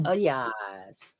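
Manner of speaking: ordinary talk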